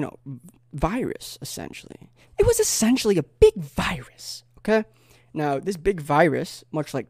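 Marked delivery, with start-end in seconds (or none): 2.4-4.8 high, loud restatement; mock spelling-out. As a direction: raise pitch and volume to hammer it home. Mock spelling-out for slow listeners.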